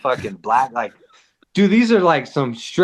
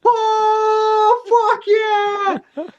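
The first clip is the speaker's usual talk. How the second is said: hammed-up celebratory shout at the fireworks; excited whoop; much slower than usual. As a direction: yell it like the best night ever. Quoting their own excited reaction.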